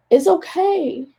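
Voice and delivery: comforting herself through real tears; crying. Real, not acted. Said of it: "more so I'm crying".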